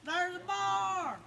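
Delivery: high, loud cartoonish yelling of the warning; hammed-up high shouting back and forth; panicked bear warning. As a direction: shriek it down the line like the bear is right there.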